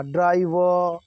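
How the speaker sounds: ड्राइवर with a drawn-out "vaa" ending; American silent R. Shown as a demonstration. Teacher spelling out the ending, silent R. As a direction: stretch the open end of ड्राइवर, no R. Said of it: "वा करके आ".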